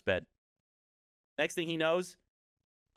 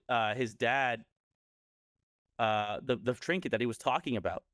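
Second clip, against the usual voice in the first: usual speaking voice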